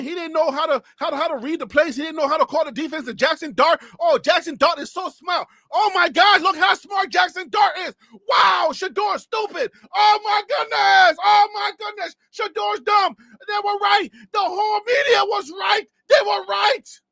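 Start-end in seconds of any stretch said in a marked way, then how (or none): 4.0-13.2 over-the-top sarcastic praise; sarcastic mock gushing. 13.5-17.0 fake-sob the media's victory; hammed-up mock lament; mock wailing.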